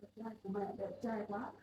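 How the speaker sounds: mother softly admiring how handsome her son has grown; tender admiration. Acted. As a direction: coo over him lovingly.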